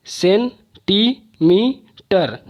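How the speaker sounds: "Centimetre" slowly, a long pause after each of सेन, टी, मी, टर; four isolated beats on "centimetre"; syllable by syllable. Shown as a demonstration. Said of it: "चार बार मुझे बोलना". ("Centimetre" का हर अक्षर अलग, धीरे-धीरे)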